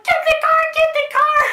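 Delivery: high, loud shriek for escape; hammed-up childhood panic yell; panicked scream. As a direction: scream it like fleeing for your life. Retold panicked scream while fleeing.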